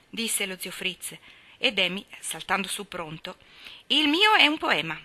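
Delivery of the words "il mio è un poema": high, loud, self-important declaration; solemn announcement. Acted. Demi proudly presenting his poem to the class, solemnly. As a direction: announce it like a grand event. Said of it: "annunziò con tono solenne".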